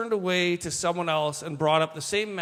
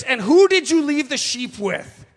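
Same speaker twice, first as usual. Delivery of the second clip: put-on loud and higher, scolding the kid brother; 6 semitones higher, 9 dB louder than usual. Older brother scolding David over the sheep, loud. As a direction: bark the question at him. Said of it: "his biggest concern is".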